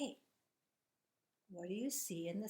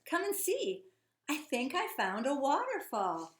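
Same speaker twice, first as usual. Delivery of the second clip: put-on excited girl; 6 semitones higher, 6 dB louder than usual. Girl's voice read aloud.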